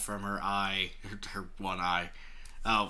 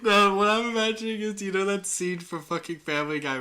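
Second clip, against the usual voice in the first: excited; 11 semitones higher, 7 dB louder than usual. Real, not acted. Excited high outburst.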